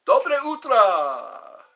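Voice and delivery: slow, loud Russian Dobre utro; slow Russian accent; Russian greeting. Shown as a demonstration. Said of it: "I wanna learn more Russian".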